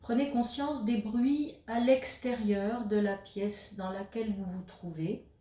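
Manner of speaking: guided meditation. Acted. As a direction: guide the listener into stillness, leaving space between phrases. Guides a yoga nidra session. (voix posée de méditation guidée, avec de longues pauses)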